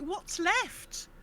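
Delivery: ordinary talk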